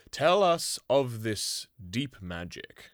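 slow, solemn and grave; grave lion king. Acted. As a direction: speak it slowly like a wise lion. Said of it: "answered Aslan gravely".

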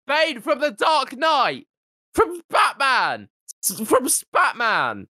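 high, slow exasperated exclamation; incredulous, drawn-out high cry; exasperated calling out. Real, not acted. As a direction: spell it out in high-pitched disbelief. Exasperated explaining who Bane is.

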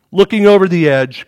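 usual speaking voice

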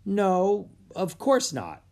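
high, emphatic, puzzled denial; confused. A narrator's reading. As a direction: answer as if baffled by the question. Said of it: "He looked confused".

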